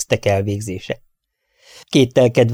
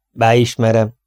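usual speaking voice

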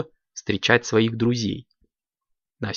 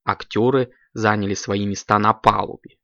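plain reading voice

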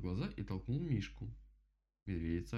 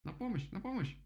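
высокий испуганный птичий голос; зови на помощь высоко, изображая крик; крик о помощи; 11 semitones higher than usual. A narrator's reading. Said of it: "закричал дятел". (испуганный крик дятла)

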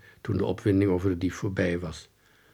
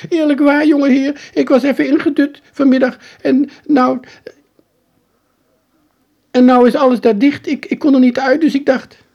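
high, loud, outraged working man protesting innocence; a caught painter squeaks his innocence, pitched way up; indignant painter; 11 dB louder than usual. Acted. Trapped painter protests to the boy, high and indignant.

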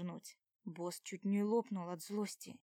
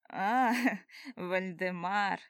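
laughing; 7 dB louder, much slower than usual. A narrator's reading. Recognizes the man, laughs.

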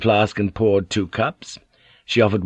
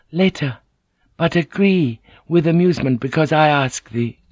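lightly raised, teasing lady's voice; Mariko insisting; 7 semitones higher than usual. A narrator's reading. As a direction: insist sweetly that he agree.

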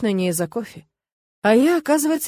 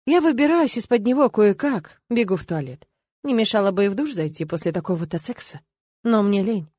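ordinary delivery, nothing marked

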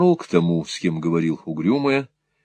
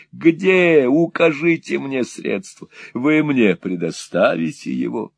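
pleading; 6 semitones higher, 5 dB louder than usual. A narrator's reading. Desperate old man pleads.

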